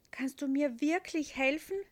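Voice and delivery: put-on boy asking the angel for help; hopeful. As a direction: ask with wide hopeful eyes. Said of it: "fragt er hoffnungsvoll".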